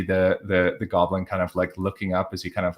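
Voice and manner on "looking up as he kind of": ordinary talk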